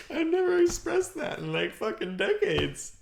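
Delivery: high, half-crying laugh through words; laughing confession. Real, not acted. Admitting decades of held anger, laughing high.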